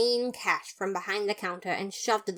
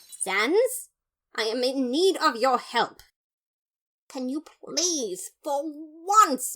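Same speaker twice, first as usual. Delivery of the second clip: loud, higher earnest plea; pleading brother; 6 semitones higher, 5 dB louder, slower than usual. Acted. Papyrus begging Sans to take him seriously, loud and high.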